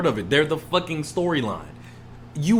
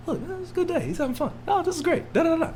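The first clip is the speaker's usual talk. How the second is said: high, soft sing-song mock report; mock cheery notes; 13 semitones higher, 5 dB quieter, faster than usual. Mocking cheerful daily notes to the co-host, high and light. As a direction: float it up high and sweet, mocking the paperwork.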